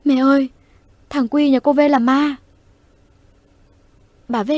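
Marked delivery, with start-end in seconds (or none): 0.0-2.4 put-on high, loud terrified child's shriek; a boy wailing to his mother in fright; crying scream. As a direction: shriek it out through tears of terror. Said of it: "khóc thét lên".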